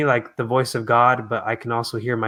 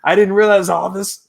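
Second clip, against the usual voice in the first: emotional realization; 6 semitones higher, 4 dB louder than usual. Retold or imitated. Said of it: "I'm about to get emotional".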